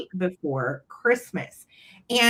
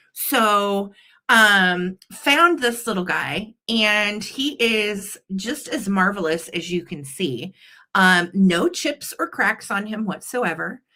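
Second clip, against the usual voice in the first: ordinary delivery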